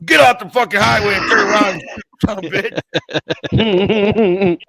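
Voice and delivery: high, loud imitated shout, cracking into laughter; mock furious trucker yell. Trucker yelling at the prankster over the CB, high loud mock shout.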